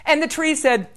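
ordinary delivery, nothing marked

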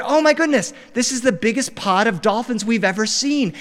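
high-pitched, thrilled outburst; excited amazement. Acted. Whale-watch guide spotting a huge dolphin pod, high excited amazement. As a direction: gush in wonder at the sight.

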